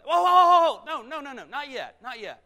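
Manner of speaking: high, very loud whoa, then easing down; urgent calling back. Acted. Scorpion stops the frog leaving, urgent high shout. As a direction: halt someone who is walking off.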